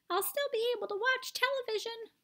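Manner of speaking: very high tiny voice; squeak. A narrator's reading. Tiny boy answering his mother, squeaky. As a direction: squeak like a mouse. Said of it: "squeaked the tiny voice".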